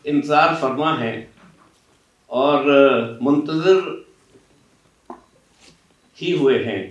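normal talking voice

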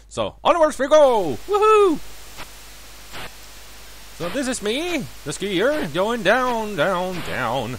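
ordinary talk, nothing marked

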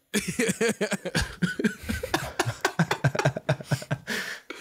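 mimicking a friend's giggle; a teasing copy of his laugh; imitated giggle. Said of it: "he's like".

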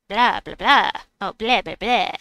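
loud cartoon vampire blahs; mock vampire. Streamer teased as a vampire, hammed-up vampire blahs. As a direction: punch out blahs like a joke vampire. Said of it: "because I'm a vampire".

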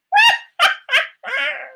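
high, loud staccato cackle; mocking stepmother laugh. A narrator's reading. Stepmother laughing at Cinderella's request, mocking high laugh. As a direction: laugh out in high, separate bursts. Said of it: "Rió su madrastra".

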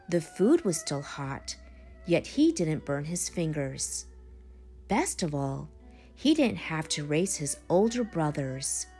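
ordinary delivery

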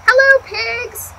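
high, slow greeting as Duck; cheerful duck greeting. Acted. Duck greeting the pigs, high and slow. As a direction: draw out a bright hello up high.

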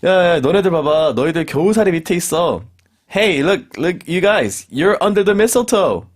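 loud, high teasing call; excited teasing. Acted. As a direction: tease them loudly. Teasing friends under mistletoe.

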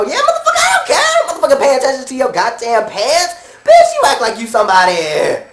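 high-pitched yelp dropping into a scolding; hammed-up indignant retort; exasperated outburst. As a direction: burst out high, then tell her off. Acted quarrel with the customer.

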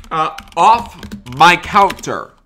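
loud, slow "off my counter"; angry yell. Acted. Banker yelling at a man climbing the counter, angry yell. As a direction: bark him off the counter.

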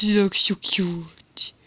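breathy whisper, slow, no voice. Real, not acted. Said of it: "I don't know why I'm talking like this".

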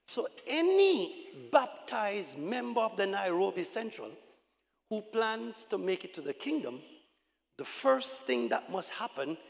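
usual speaking voice

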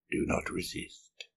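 soft, whispery order to a captive; hushed seer's warning; hushed command. A narrator's reading. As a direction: command quietly, almost all breath. Seer commands her bound captive.

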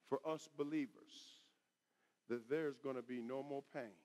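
very soft, low pulpit voice; hushed, consoling preaching. Real, not acted. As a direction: quietly assure the believers.

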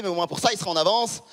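normal talking voice

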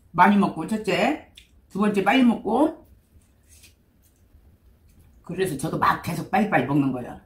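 chewing while talking; talking between spoonfuls; mouth full. Real, not acted. Seen 1: spoon at mouth, chewing while speaking throughout labelled words.

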